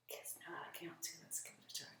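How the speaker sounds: whispered aside, fast at first. Real, not acted. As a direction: lean in and whisper to the audience. Aside to the audience.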